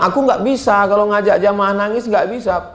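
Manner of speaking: high-pitched retold protest; acted-out reluctant refusal. Retells refusing to lead weeping.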